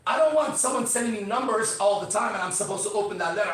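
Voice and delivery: ordinary talk, nothing marked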